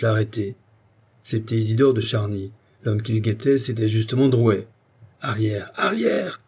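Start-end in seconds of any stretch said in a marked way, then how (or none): 5.2-6.4 commanding cry. A narrator's reading. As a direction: order the crowd back, the repeat pitched high. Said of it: "cria-t-il". (ordre crié, de plus en plus haut)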